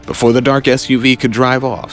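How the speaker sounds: ordinary delivery, nothing marked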